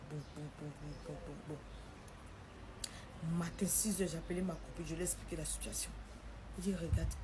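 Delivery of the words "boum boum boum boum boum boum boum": soft, low, booming; words echoing like drumbeats; heartbeat thuds. Retold or imitated. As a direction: imitate a pounding heart softly. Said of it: "ça résonne dans ma tête comme ça".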